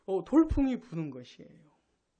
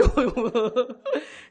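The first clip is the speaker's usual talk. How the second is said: amused laugh; 4 dB louder than usual. Real, not acted. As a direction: laugh out loud at the congregation's response. Laughs after joking with congregation. (웃음을 터뜨림)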